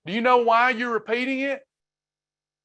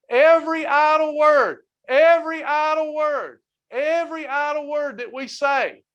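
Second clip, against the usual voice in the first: higher, louder preacher's cry on every; preaching at full force; 6 semitones higher, 3 dB louder than usual. Real, not acted. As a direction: hammer home every idle word. Preacher building to a peak.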